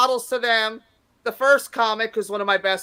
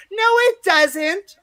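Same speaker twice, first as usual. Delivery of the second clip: high, drawn-out mock protest; drunk lady; 9 semitones higher, 4 dB louder, much slower than usual. Drunk lady answering a joke, imitated indignant protest. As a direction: imitate a tipsy woman objecting, slow and high-pitched. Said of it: "There was a drunk lady one night".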